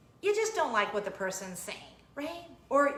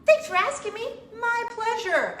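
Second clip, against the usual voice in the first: much higher, louder overly cheerful reply; mock sweet teen; 9 semitones higher, 7 dB louder than usual. Mom imagining an impossibly cheerful daughter. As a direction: gush like a perfect eager child. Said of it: "she goes".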